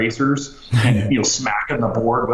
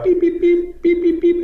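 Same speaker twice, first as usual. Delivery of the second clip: very high, monotone electronic beeps; high-pitched mimicked pager alert; beeper alarm; 3 dB louder than usual. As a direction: imitate a pager going off in rapid, piercing beeps. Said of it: "it goes off. It's like".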